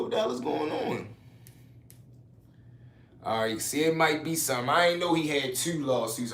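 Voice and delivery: ordinary delivery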